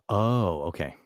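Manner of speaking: ordinary delivery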